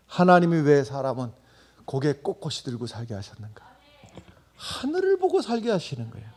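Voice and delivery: loud, rising preaching cadence; forceful preaching. Acted. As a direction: drive the point home to the congregation. (힘찬 설교 목소리)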